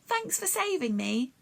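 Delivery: high, tired little bee struggling to speak; a faint, effortful bee voice; the hurt bee thanking Jack, high and weary; weak bee voice. A narrator's reading. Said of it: "speaking took a lot of effort".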